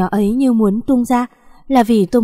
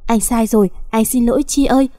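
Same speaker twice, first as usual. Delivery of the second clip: ordinary delivery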